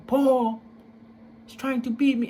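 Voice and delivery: high, frantic cry for help; a panicked mother calling her son; mother's plea. Retold or imitated. Mother begging her son for protection, high and frantic.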